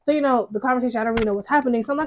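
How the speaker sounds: ordinary talk, nothing marked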